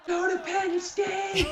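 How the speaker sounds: strained high-pitched holler, re-enacting a cheer; high imitation of yelling from the stands; retold yelling. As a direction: yell it out as if across an arena, without full volume. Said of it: "I'm basically yelling".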